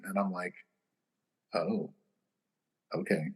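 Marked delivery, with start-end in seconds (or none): 1.5-3.3 unimpressed. Retold or imitated. Sees Joel Osteen book.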